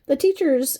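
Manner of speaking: amused by the case name, voice jumping up; amused exclaim. Real, not acted. Reading the abbreviation aloud and cracking up, high and loud.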